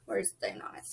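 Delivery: whispered under her breath; quick whisper to herself; whispered aside. Real, not acted. Vlogger fumbling with her fan, whispering to herself.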